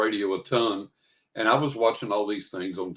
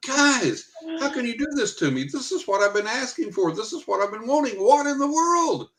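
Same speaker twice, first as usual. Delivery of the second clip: high, wailing complaint; mock-outraged appeal to the guys; exasperated protest; 13 semitones higher than usual. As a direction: plead indignantly with pitch way up. Retold protest to spirit guides.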